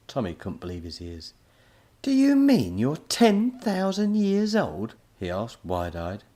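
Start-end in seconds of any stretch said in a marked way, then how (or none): 2.0-4.9 astonished. A narrator's reading. Tommy wide-eyed.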